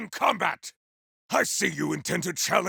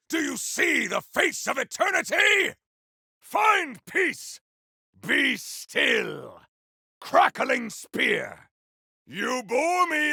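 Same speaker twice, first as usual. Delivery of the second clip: a warrior shouting combat callouts, fierce and proud; defiant warrior battle cries; battle barks. Acted. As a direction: bark each line like a fighter mid-battle.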